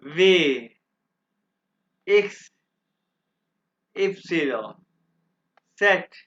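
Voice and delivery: letters said one by one with long pauses; teacher's second full reading of the letters; German alphabet recital. Shown as a demonstration. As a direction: spell out the German alphabet for the class. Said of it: "अब मैं दोबारा इसको pronounce करूंगा".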